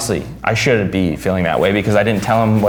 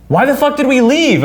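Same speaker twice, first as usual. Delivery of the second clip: incredulous outburst; 13 semitones higher than usual. Retold or imitated. Cousin learns he was high.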